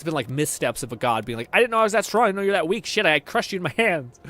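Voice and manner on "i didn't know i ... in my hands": high and loud, a clumsy god's apology; hammed-up booming deity; mock god. Streamer mocking a clumsy god, loud and high.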